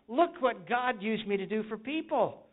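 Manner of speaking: mock joyful, laughing through it; beaming imitation of a happy believer. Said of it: "you say".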